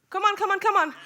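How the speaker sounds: ordinary delivery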